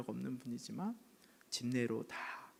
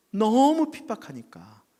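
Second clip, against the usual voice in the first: slow, louder, higher stress on the persecution; emphatic retelling; 6 semitones higher, 10 dB louder, slower than usual. Retold or imitated. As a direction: lean hard into how bad it was. (힘주어 강조하는 말투)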